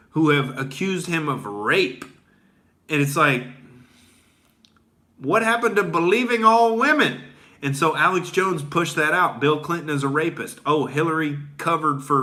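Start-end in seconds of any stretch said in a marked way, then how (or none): 5.2-7.2 high, sarcastic challenge; mocking question. Mocking critics who preach believing all women, high sarcastic voice. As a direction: toss the slogan back with scorn.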